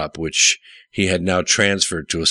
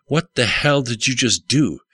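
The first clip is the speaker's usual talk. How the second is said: mild narrated shout; 6 semitones higher than usual. A narrator's reading. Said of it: "the pilot shouted".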